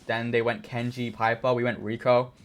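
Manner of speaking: normal talking voice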